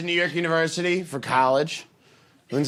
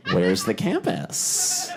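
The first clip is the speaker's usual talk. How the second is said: slow, low, puzzled question; bewildered; 8 semitones lower, much slower than usual. Acted. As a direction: ask slowly, quite lost. New student, lost, asks guide.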